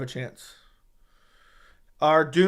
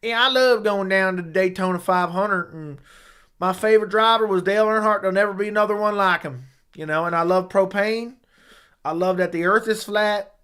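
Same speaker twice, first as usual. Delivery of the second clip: country accent; 3 semitones higher than usual. Shown as a demonstration. Streamer giving ten-out-of-ten effort at a country accent. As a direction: ramble like a country racing fan. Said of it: "What's your best country accent?".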